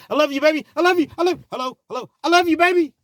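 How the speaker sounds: high, loud mock pleading boyfriend, love you baby. Jailed boyfriend to girlfriend on phone, high mocking plea. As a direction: squeal love you as the call cuts.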